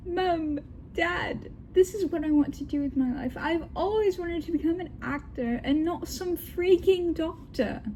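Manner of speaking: put-on high-pitched British teenager voice; British accent. Daughter defies her parents over acting, in a put-on British accent. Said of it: "let me do the British accent all the way through".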